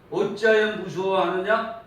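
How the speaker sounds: loud reproachful question; stern rebuke. Retold or imitated. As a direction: call them out for their fear. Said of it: "이렇게 책망합니다".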